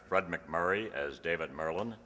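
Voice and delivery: normal talking voice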